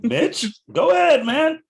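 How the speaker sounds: high, loud calling out. Real, not acted. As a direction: call him out loud and high. Urging friend to close.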